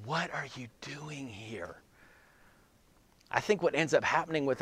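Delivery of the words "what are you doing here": near-whispered, slow and much softer. Acted. God's still small voice to Elijah, soft whisper. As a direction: breathe the question gently like a whisper. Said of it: "a soft whisper".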